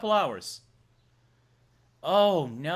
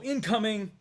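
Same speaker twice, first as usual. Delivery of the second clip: announcing call; 5 semitones higher than usual. Acted. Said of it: "so I'll just go".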